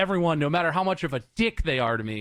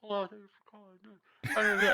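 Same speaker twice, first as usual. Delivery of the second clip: mock hushed greeting; 4 semitones higher, 7 dB quieter, slower than usual. Mocking a quiet phone agent.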